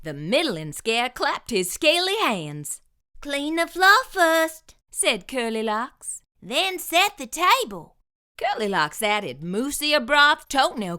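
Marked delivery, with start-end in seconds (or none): 3.2-4.8 higher, firm little housekeeper; lightly voiced bossy cook; Curly Locks voice. 6.4-8.0 higher, firm little housekeeper; lightly voiced bossy cook; Curly Locks voice.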